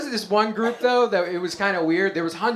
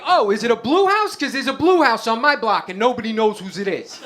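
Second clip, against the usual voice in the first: hammed-up Guido, pitched up and eager; Guido cousin; 3 semitones higher, 4 dB louder than usual. Other cousin answering without skipping a beat, eager Guido voice. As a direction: jump in as a dim, helpful Guido. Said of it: "my other Guido cousin's just like".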